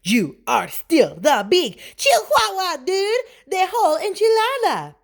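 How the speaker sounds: high, loud mock Spanish accent. A narrator's reading. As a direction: exclaim in a hammed-up Spanish accent. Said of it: "using his very best Spanish accent".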